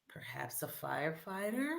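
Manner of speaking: soft low whisper. Real, not acted. Host hinting at a guess to the audience, whispered. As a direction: breathe the guess secretly. Guessing a boy's future job.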